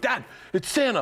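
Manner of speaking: put-on high-pitched excited child calling Dad; imitated daughter. Daughter tells Dad who is on the phone, high and excited. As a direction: pipe up like a little girl announcing news. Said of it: "My daughter would go".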